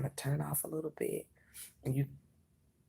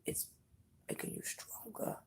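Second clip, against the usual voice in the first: hushed whisper. Real, not acted. Whispers the reading.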